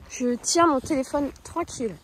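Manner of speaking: ordinary delivery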